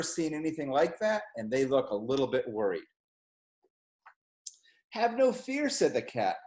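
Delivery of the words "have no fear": higher, sing-song reassuring cat; cat calming the fish; cheery cat voice. A narrator's reading. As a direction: soothe them like a jolly showman.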